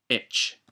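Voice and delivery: "etch" said in a voiceless breathy way, the ch hissed; ch sound. Shown as a demonstration. Teacher demonstrating the hatted C to learners, breathy ch. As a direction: push out the "ch" of "etch" on air. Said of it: "it's pronounced ch".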